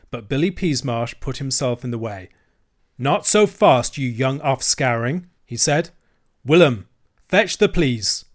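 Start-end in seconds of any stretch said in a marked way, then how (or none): 3.0-8.2 threatening dealer. Acted. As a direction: stop the boy cold. Dealer stops the children.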